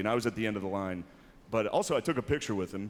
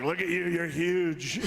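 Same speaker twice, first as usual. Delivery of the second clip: higher, lightly voiced Trump; Trump impression; 7 semitones higher, much slower than usual. Retelling Trump's compliment in his voice. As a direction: mimic the president greeting a big guy. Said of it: "His Trump impression is actually really good".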